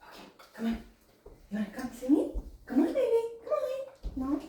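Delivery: high coaxing voice; talking to a pet. Real, not acted. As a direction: call the bird sweetly. Coaxing bird.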